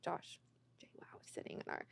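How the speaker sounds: hushed, urgent whisper to a partner; retold whisper. Wife alerts husband that his idol is in their row, whispered. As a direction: whisper it so the star nearby can't hear.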